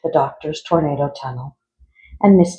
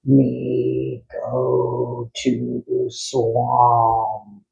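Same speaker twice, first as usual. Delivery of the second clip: mild monster bellow; 2 semitones lower, much slower than usual. Acted. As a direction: moan it out like a lumbering frog. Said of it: "bellowed Frankenfrog".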